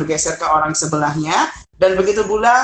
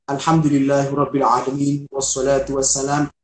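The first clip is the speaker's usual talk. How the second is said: overdone Arabic recitation; 4 semitones lower than usual. Shown as a demonstration. Teacher showing a wrong, overdone opening recitation. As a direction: recite it like a pesantren student. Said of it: "Jangan sampai teman-teman seperti itu".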